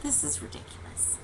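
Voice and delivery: very soft, low aside; private thought. Retold or imitated. Driver thinking to herself on the road, hushed private thought. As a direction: murmur it to yourself, almost whispered. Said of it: "just thinking to myself".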